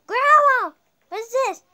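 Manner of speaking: gasping, high and loud; sudden pretend shock; surprised gasp. Pretend play, sudden discovery.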